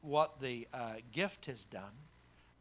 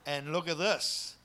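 ordinary talk

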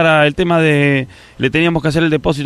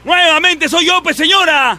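gritando; 14 semitones higher, slower than usual. Acted. Gritando al teléfono.

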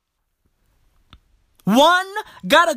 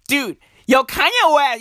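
ordinary talk, nothing marked

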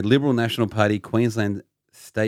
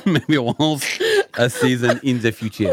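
put-on slow French accent through suppressed laughter; hammed-up French accent, drawn out; 3 semitones higher, 3 dB louder, slower than usual. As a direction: hold the comic French voice while cracking up. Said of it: "trying to do a French accent".

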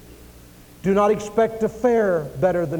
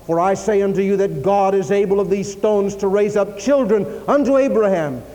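raised, forceful preaching in ringing phrases; fiery prophet's denunciation; John the Baptist; 4 semitones higher, 5 dB louder than usual. Acted. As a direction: declaim it as a stern warning from the pulpit. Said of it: "that's the kind of preacher John was".

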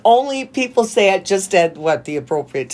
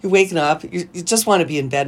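usual speaking voice

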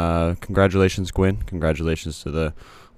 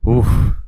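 loud impressed oof; 6 semitones higher, 10 dB louder than usual. Real, not acted. Reacting to heavy weight.

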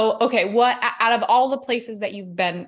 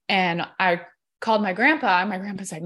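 ordinary talk, nothing marked

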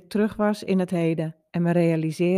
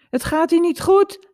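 raised, higher-pitched call; high, loud cry of alarm; 10 semitones higher, 5 dB louder than usual. A narrator's reading. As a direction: call out to someone in another room. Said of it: "riep ik naar de badkamer".

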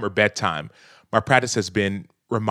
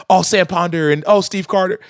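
put-on higher and louder, excited little kid; imitating his son; 7 semitones higher, 5 dB louder than usual. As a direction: play the eager five-year-old naming guests. Imitating his five-year-old son.

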